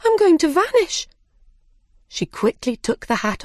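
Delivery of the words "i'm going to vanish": very high-pitched panicked exclamation; alarmed cry. A narrator's reading. Alice fears she will shrink away, high alarmed cry. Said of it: "she cried".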